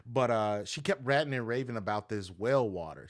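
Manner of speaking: ordinary talk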